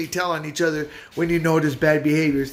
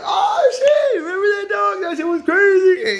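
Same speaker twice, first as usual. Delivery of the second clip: much higher, loud excited recognition; loud excited shout; 4 dB louder than usual. Retold or imitated. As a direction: blurt it out in a high, excited shout. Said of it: "you know me, I'm fucking loud as it is".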